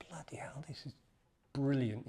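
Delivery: hushed, breathy amazement; a quiet bloody hell; awed whisper. Retold or imitated. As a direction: whisper it in wonder.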